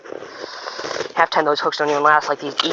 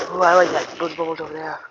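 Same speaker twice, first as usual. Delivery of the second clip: put-on European accent. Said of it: "I start talking like I'm from, from Europe".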